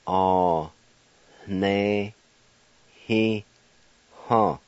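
Ah, ne, he, ha with a faint breathy h; syllable by syllable. Shown as a demonstration. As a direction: separate each syllable slowly. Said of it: "like this".

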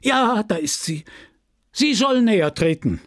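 high-pitched exclamation; crowd calling out. Acted. Crowd spots the missing prize winner, calling out. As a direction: point out the person and urge them forward. Crowd voices answering the mayor.

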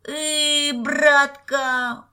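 high, loud, drawn-out moronic bleat; idiot wail. A narrator's reading. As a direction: wail for big brother like a drooling fool. Boy playing idiot, drooling, whining. (придурочно, протяжно воя)

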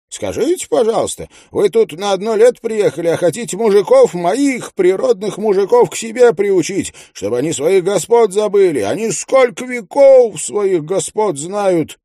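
high, loud shrill tirade; shouting widow. A narrator's reading. Widow screams at neighbour over hurt pig, high and loud. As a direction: hurl every grievance at him. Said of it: "кричит вдова".

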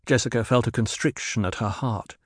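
ordinary delivery

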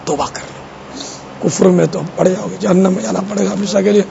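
put-on quietly urging a lost young man, tender and soft. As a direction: coax him lovingly to repent. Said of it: "پیار سے کہا کہ".

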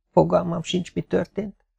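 soft, high, lightly voiced near-whisper. A narrator's reading. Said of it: "suttogta Nikolá". (halk, magas hangú suttogás)

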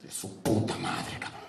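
low slow unvoiced grumble; glum dwarf. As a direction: grumble it out, deflated.

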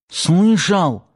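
high, timid whispered question; frightened babble. A narrator's reading. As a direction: ask in a scared murmur. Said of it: "пролепетал Паштет".

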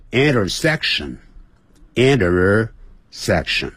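slow American "intersection" with curled r; American accent, tongue curled on every r. Shown as a demonstration. Teacher showing American pronunciation of intersection, slow. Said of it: "那美式就intersection".

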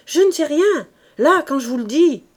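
loud, high shouted denial; shouting. A narrator's reading. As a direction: shout it on your word of honour. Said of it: "Elle criait".

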